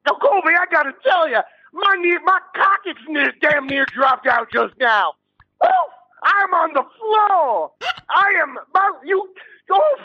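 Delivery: high, breathless, overwrought hollering; hammed-up excitement. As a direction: wail like it's too much to bear. Overcome by lewd excitement.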